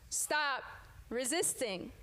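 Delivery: ordinary delivery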